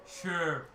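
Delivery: SHERE said as one syllable, like "Sure"; silent final E. Shown as a demonstration. Game master corrects players on the goblin's name, final E silent. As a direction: say "Sure" as one word, no sound on the last E. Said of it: "the last E is silent".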